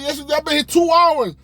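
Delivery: high, loud, stubborn yes-you-did; high mock-outraged teen; high indignant insisting. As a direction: dig in like a wronged kid. Arguing with the cashier.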